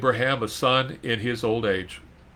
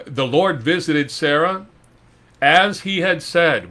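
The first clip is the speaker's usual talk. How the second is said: emphatic scripture reading; 5 semitones higher, 5 dB louder than usual. Acted. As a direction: proclaim the verse with weight.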